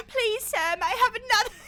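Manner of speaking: high, loud British begging; hammed-up British orphan plea; bad British accent. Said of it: "this really shitty British accent".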